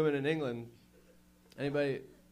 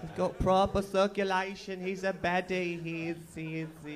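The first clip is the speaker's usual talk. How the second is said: mocking sing-song; 5 semitones higher than usual.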